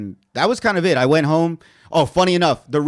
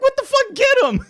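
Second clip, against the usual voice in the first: very high, loud cry to attack; knocked-down kid yelling at his buddies; dazed outraged yell; 6 dB louder than usual. Retold or imitated. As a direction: scream for your friends to get him. Dazed after punch, yelling.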